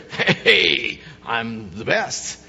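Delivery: preen like a vain queen; smug queen voice. Retold or imitated. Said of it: "the queen loves to hear".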